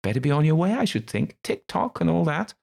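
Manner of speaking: high, loud dismissive shoo. Acted. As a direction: wave him off, bright and loud. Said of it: "he shoos me off".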